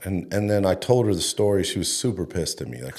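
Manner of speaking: ordinary talk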